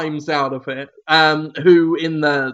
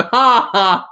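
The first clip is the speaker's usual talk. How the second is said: high spoken "ha ha" guffaw; fake, emphatic laughter; mock audience laugh; 6 semitones higher, 3 dB louder than usual. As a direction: imitate a studio audience laughing. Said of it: "everyone would go".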